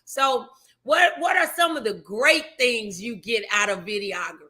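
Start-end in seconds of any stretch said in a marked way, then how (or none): none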